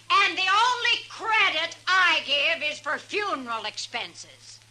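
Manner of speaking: normal talking voice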